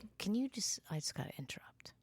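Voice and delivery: hushed unvoiced whisper. Real, not acted. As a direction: whisper it quickly. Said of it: "why are you whispering?".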